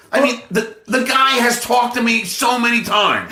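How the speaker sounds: insist, fed up, pushing back; exasperated insistence. Real, not acted. Insists he knows Trump.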